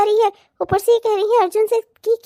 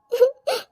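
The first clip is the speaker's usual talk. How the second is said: sob; 6 semitones higher than usual. Acted. Daughter recalling her mother's suffering, sobbing. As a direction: break into a brief high whimper. Bride sobbing over broken vow.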